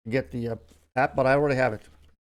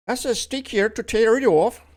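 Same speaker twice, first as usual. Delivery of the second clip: playful hammed-up Scottish or Irish brogue, higher and louder; a loose Irish-Scottish lilt, pitched up and loud; mock Scottish-Irish accent; 9 semitones higher, 8 dB louder than usual. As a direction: toss the line out in a jokey Celtic accent. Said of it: "Scottish or, or, I don't know, Irish".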